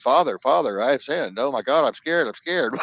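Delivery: mock confession. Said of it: "I've even thought about going saying".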